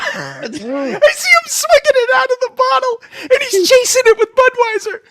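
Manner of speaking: high voice cracking with laughter; laugh-crying the punchline; laughing while telling. Real, not acted. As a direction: push the words out through helpless laughter. Laughing while telling about dad.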